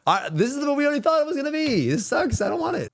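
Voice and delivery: high mocking whine; whiny mock voice. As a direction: mimic someone whining over nothing.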